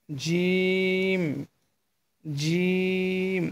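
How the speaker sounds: "জিম" slightly heavy, long steady vowel; slightly heavy letter. Shown as a demonstration. Teacher models the letter for learners, "জিম" drawn out and slightly heavy. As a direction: hold "জিম" out evenly with a full, thick sound. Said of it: "এটা একটু মোটা হবে".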